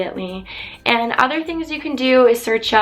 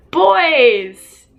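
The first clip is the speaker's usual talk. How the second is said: excited; 5 semitones higher, 5 dB louder than usual. Real, not acted. Teasing topic announcement.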